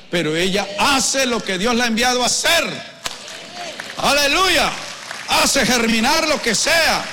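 loud, high pulpit proclamation; ringing exhortation to the congregation; fervent preaching. Real, not acted. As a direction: preach it at full force, voice lifted high. Preaches at full force.

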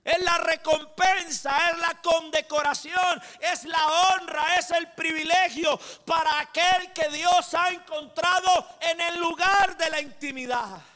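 loud, high, ringing sermon proclamation; full-force preaching. Acted. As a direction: proclaim it like a revival preacher to the whole church. Pastor preaches to congregation.

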